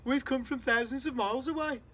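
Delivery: loud, high, cheeky call down; grinning shout. Acted. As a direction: shout back, enjoying the fuss. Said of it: "the centipede shouted back".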